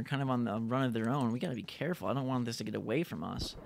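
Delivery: ordinary delivery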